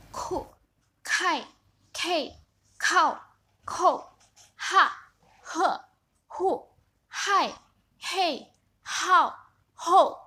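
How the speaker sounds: each syllable stamped down hard from high, like an angry snap; sharp falling fourth tone. Shown as a demonstration. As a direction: hit every syllable high and drop it sharply. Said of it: "ini dihentak seperti orang marah". (nada keempat dihentak tajam seperti orang marah)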